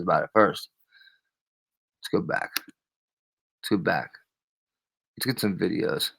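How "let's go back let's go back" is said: hushed, breathy whisper to himself; whispered aside. Real, not acted. Streamer whispering to himself while pulling up clips, hushed. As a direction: whisper it under his breath.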